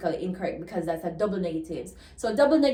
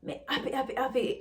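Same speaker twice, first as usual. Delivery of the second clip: patois "happy" repeated, higher and softer; Jamaican patois; 4 semitones higher, 6 dB quieter, much faster than usual. Shown as a demonstration. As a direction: Jamaican patois, light and lifting on each "happy". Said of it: "repetition of adjectives for emphasis".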